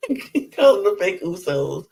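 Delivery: laughing through the words. Real, not acted. Amused vlogger retelling the insult, laughing. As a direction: chuckle as you say it.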